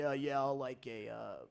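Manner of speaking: very low, soft drive-through order; deep, quiet, low-pitched dad voice; Billy's father. Acted. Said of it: "my deeper Billy's father voice".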